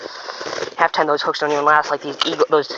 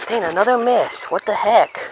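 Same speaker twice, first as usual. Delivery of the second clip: higher, exasperated groan at the miss; 6 semitones higher than usual. Real, not acted. Angler missing another bite, exasperated and higher. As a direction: let the frustration out, pitched up.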